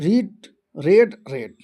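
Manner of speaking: रीड long ee, then रेड and रेड with short e; three pronunciations. Shown as a demonstration. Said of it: "पर pronunciation अलग-अलग होता है". (रीड को "रीड" और रेड को "रेड" बोलकर दिखाइए)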